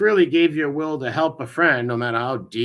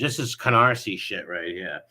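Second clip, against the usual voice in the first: usual speaking voice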